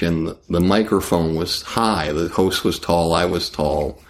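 ordinary talk

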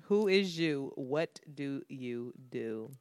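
low, quiet Philly recap, drawn out; most Philly way. Retold or imitated. Recalling the girl's challenge to herself, slow Philly way. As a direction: repeat the Philly challenge slowly, under your breath. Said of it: "This is the most Philly shit".

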